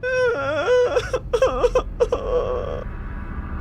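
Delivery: high-pitched wailing sob; acted high, whimpering cry; childlike sobbing. A narrator's reading. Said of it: "started to sob like a child".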